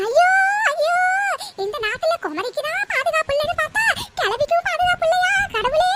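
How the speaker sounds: hammed-up crying to the heavens; tearful wailing. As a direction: wail it out, stretching the line endings. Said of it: "அழுதுட்டு இருக்கீங்க".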